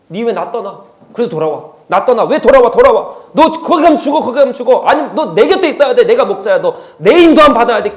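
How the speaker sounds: loud, high pleading command; the Lord calling out. Acted. The Lord calling a straying believer back, loud and urgent. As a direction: shout like a shepherd calling back a lost sheep. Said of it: "근데 주님은 어떻게 하십니까?".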